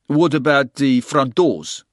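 put-on questioning handyman in Afrikaans accent; thick Afrikaans accent. A narrator's reading. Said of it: "he said in a thick Afrikaans accent".

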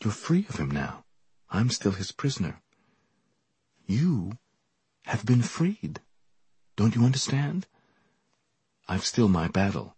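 near tears. Acted. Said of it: "He seemed near tears".

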